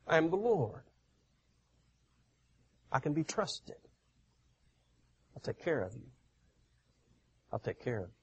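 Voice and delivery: soft, hushed, calming voice of the Lord; tender reassurance. Retold or imitated. The Lord promising the worried preacher, soft and tender. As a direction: reassure him softly, like a caring father.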